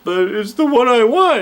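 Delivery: whiny customer. Retold or imitated. Imagined customer whining.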